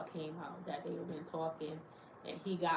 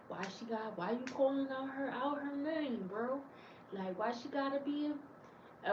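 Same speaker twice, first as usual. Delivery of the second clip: higher, softer put-on dude voice; imitating the guy; 7 semitones higher, 4 dB quieter than usual. Guy defending the girl he liked, in a higher mock voice. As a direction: play the other guy pushing back, higher.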